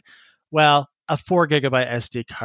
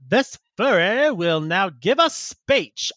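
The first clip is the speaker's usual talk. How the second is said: slow, higher mock Southern proclamation; Southern orator; 4 semitones higher, slower than usual. Friend presents the furry for a speech, mock Southern drawl. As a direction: announce it in a put-on Southern drawl. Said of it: "like, Confederate Southern".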